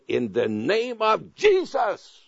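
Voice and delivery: high, slow, drawn-out command in Jesus' name; commanding rebuke. Retold or imitated. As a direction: rebuke him with full authority, stretching each word. Rebuking a demonic attacker.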